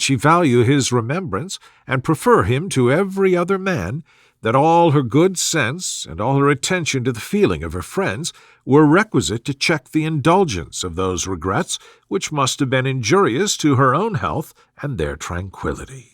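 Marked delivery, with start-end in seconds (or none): none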